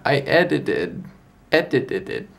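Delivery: retry "edited" and still muddle the syllables; "edited" stumbled through on a retry; tripping over word. Real, not acted. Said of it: "I can't say that word".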